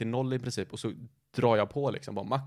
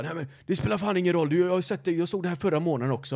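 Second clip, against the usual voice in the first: higher, louder mock rant; angry neighbour; 6 semitones higher, 4 dB louder than usual. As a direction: rant like the furious neighbour, half-acted. (högre, argt härmad granne som skäller)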